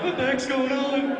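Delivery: loud, slow cow voice; puzzled talking cow. Acted. Cow answering the farmer, slow comic cow voice. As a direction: drawl it out as a bewildered cow. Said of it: "Cow's like".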